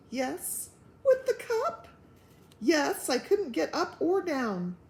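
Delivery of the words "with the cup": very high, louder incredulous question; shocked disbelief. Acted. Wife shocked to hear about the cup, high shocked question. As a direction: shriek it in disbelief.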